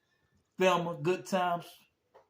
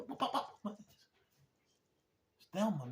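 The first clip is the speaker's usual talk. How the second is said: ordinary talk, nothing marked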